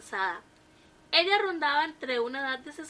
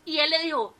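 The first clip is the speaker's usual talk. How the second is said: plain reading voice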